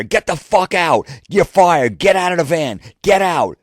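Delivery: high, loud firing tirade; furious screaming. Retold or imitated. Boss firing employee and ordering him out of the van, high furious screaming. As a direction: scream short orders at the top of your voice.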